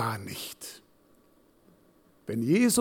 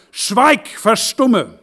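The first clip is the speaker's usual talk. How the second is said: booming rebuke, higher and louder; stern command; 6 semitones higher, 7 dB louder, much slower than usual. A narrator's reading. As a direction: command the storm to be silent. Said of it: "bedrohte den Wind und sprach".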